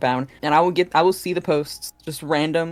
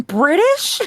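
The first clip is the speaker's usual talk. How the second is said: incredulous quote; 11 semitones higher, 5 dB louder than usual. Retold or imitated. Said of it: "Jamie coming in my comments saying".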